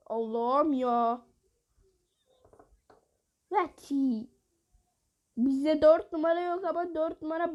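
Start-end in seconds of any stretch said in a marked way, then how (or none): none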